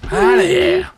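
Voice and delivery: very high, loud falsetto; mock pop-star voice. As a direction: squeal it in a silly falsetto. Echoing quote in [name] falsetto.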